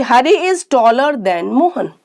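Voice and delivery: slow classroom dictation; slow example sentence. Shown as a demonstration. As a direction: read the example slowly and clearly. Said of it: "second देखिए".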